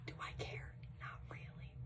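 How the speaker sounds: very soft, voiceless whisper; whispered aside. Real, not acted. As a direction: confide it under your breath. Aside about the mom's opinion.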